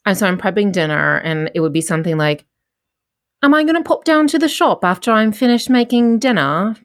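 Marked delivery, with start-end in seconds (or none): 3.4-6.8 chatty British accent, pitched up a little. Shown as a demonstration. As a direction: play a Coronation Street Brit musing at home. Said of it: "I talk to myself in a British accent".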